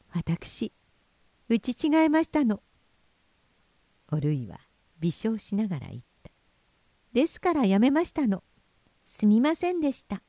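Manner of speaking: high, gentle smiling voice. A narrator's reading. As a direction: answer serenely with a smile. (微笑みながら穏やかに)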